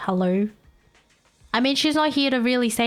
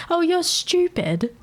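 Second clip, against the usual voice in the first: mock posh; 9 semitones higher, slower than usual. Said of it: "posh version".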